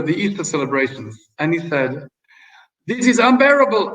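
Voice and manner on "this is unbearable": higher, louder line in a mock French accent. French ambassador complaining in a letter home, hammed-up French accent. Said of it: "I'm making this with a French accent".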